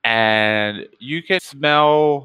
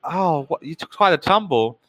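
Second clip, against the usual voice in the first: high, sugary hostess voice; sweet 1950s housewife; 9 semitones higher than usual. Acted. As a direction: fuss over the fallen guest, much higher. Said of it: "And she says".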